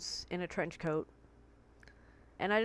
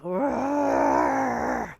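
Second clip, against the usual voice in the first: drawn-out furious growl, much louder; long raging growl; 3 semitones higher, 9 dB louder than usual. Shown as a demonstration. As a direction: let a long angry growl rip. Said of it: "It's hours of".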